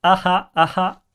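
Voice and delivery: ah ah ah like a villain; evil laugh. Shown as a demonstration. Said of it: "Comme ça".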